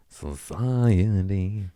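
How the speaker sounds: very slow whisper of "Society"; drawn-out whisper. Acted. Blaming society in a long mock whisper. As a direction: breathe "Society" out slowly, half mocking.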